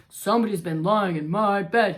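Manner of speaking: Papa Bear finding his bed used; gruff angry Papa Bear. Acted. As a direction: grumble it, not amused. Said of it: "he cried".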